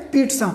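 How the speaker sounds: پیٹزا as a neat "peet-sa"; correct pronunciation. Shown as a demonstration. Teacher answers own question, پیٹزا as "peet-sa", matter-of-fact model pronunciation. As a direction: answer with پیٹزا, the "ts" clearly heard. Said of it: "اس کی صحیح تلفظ کیا ہے؟".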